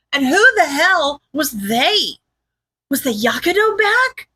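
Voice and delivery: high, incredulous string of questions; mental spiral. A narrator's reading. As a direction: spiral upward in exasperated disbelief. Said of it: "breaking her from her mental spiral".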